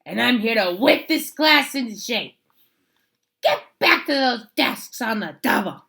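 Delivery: loud stern commands; stern witchy teacher. Acted. Miss Swamp whipping the class into shape, loud and stern. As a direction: bark orders like a strict teacher.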